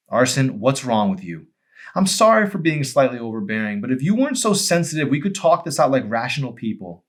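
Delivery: Emery's exasperated voice. Acted. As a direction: scold him with sharp frustration. Emery scolds Arson on porch.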